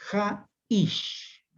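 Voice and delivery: "ha-ish" in two separated syllables, final "ish" drawn out; syllable-by-syllable demo. Shown as a demonstration. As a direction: demonstrate "ha-ish" slowly, accent on the last syllable "ish". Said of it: "recae en la última sílaba acentuada". («ha-ish» separado en sílabas, con acento en la última)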